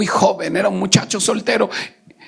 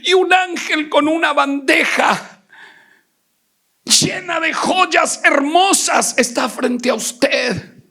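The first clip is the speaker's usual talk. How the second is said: a hint of a prophesying sister, higher; sister's vision; 10 semitones higher than usual. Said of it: "Y dice, hermano Jacobito". (leve imitación de la hermana en visión)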